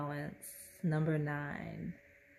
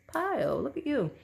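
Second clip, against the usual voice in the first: delighted; 9 semitones higher than usual. Real, not acted. Delighted by a card.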